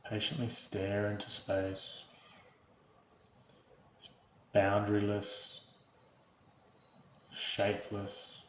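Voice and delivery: soft, unhurried meditation voice with long pauses; guided meditation. Real, not acted. Host leading viewers through breath and body awareness, calm guided meditation. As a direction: guide them gently into stillness, hushed and even.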